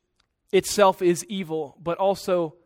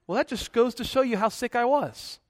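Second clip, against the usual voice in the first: feigned innocence; 5 semitones higher than usual. Retold or imitated. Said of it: "the man responded".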